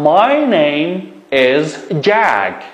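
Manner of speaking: My name is Jack, said very slowly, word by word; slow model sentence. Shown as a demonstration. Teacher dictating a model sentence for pupils. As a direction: spell it out slowly for the class.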